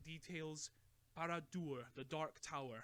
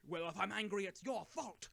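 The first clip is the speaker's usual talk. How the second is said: raised, higher accusing voice; angry; 5 semitones higher, 6 dB louder than usual. A narrator's reading. Bilbo blaming Gandalf for his anger, loud. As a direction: throw the blame back at him.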